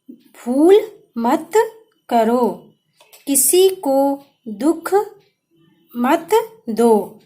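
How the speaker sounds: put-on slow, word by word, for children to copy; slow dictation. Shown as a demonstration. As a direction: dictate each word slowly and clearly. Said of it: "अब देखिए".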